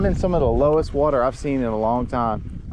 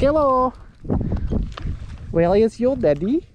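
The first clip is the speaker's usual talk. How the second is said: put-on high, cooing baby-talk voice; baby talk to a rat; 8 semitones higher than usual. Angler coos at a rat swimming by his boat, high baby talk. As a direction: coo at the little rat like a toddler. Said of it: "I don't know why I'm talking like that".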